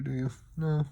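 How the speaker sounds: usual speaking voice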